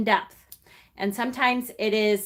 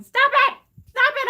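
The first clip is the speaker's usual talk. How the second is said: squeaky, much higher mock scolding; playful protest; 6 dB louder than usual. Real, not acted. Streamer teasing a viewer who can't stop crying, squeaky mock scold. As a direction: tease them for making you cry.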